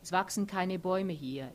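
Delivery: ordinary delivery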